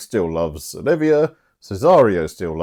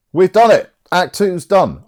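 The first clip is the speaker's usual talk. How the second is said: triumphant cheer; 10 semitones higher, 7 dB louder than usual. Real, not acted. Celebrating finishing act two.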